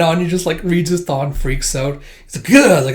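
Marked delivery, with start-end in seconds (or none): 2.5-2.9 loud grossed-out groan; disgusted ugh. Retold or imitated. Anya disgusted.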